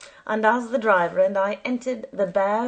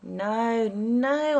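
mildly raised protest; a touch of urgent objection; crying out; 3 semitones higher than usual. A narrator's reading. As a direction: protest to the driver, understated. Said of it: "I cried again".

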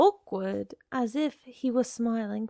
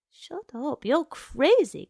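higher pitch, bursting louder on crazy; alarmed outburst; 5 semitones higher, 6 dB louder than usual. Acted. Girl backing away from the man at the door, alarmed. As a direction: recoil and cry out in protest.

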